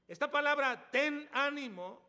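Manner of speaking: "ten ánimo" said loud, slow and high; slow stressed phrase. Shown as a demonstration. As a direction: lean hard on "ten ánimo", slowly. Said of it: "solamente fue dicha".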